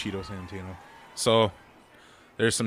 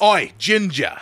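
loud, high mock British call; hammed-up British accent; British heckler; 7 semitones higher, 9 dB louder, slower than usual. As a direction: holler like a British lout.

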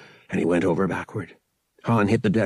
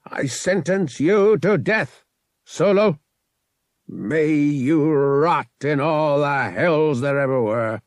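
higher, slow, savouring each word; gloating death sentence; 6 semitones higher, 4 dB louder, slower than usual. Acted. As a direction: relish condemning him. Captain sentences Han to death.

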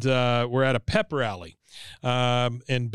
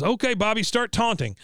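higher-pitched Hank giving the order; high coach voice; 9 semitones higher than usual. Retold or imitated. Hank telling Bobby to taunt, in a high voice. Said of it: "He goes".

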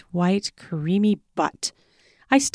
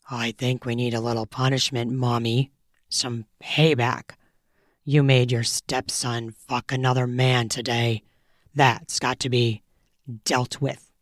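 lower, softer commanding male voice; dominant lover; 6 semitones lower, 4 dB quieter than usual. A narrator's reading. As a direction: growl the order low and close.

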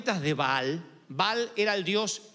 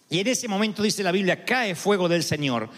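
ordinary talk

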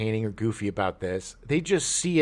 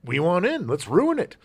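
greedy studio exec; 6 semitones higher than usual. Retold or imitated. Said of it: "the studios are like".

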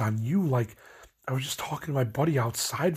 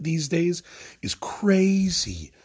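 crazy stretched out, higher and louder; exasperated emphasis; 8 semitones higher, 4 dB louder, much slower than usual. Real, not acted. Griping about coworkers dodging blame, a slow exasperated crazy. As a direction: lean hard on crazy, drawn out slow.

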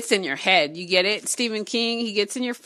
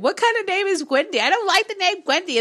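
much higher, sneering at the name; mock dismissive; 10 semitones higher than usual. Reader mimicking her own earlier scoff. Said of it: "I just said".

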